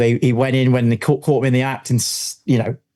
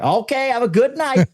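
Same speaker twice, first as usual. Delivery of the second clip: high, put-on cop sign-off; American policeman; 11 semitones higher than usual. Cop leaves the shooters to it, high imitated farewell. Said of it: "He's like".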